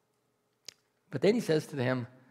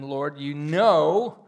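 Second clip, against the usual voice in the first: grieved; 8 dB louder, slower than usual. A narrator's reading. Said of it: "And Peter was grieved".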